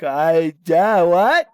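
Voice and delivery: loud, high, dim-witted "what?"; playing the idiot. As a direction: imitate someone acting clueless on purpose. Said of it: "he played like an idiot".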